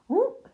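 high, loud "whoop" on impact; grunted whoop. A narrator's reading. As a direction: let out a startled whoop. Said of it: "he grunted".

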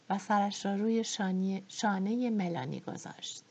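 ordinary delivery, nothing marked

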